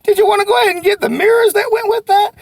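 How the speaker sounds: high, loud eager salesman pitch; confident showroom pitch; bold sales pitch. Shown as a demonstration. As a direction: sell it boldly to the customer. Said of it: "You just gotta be brave and say".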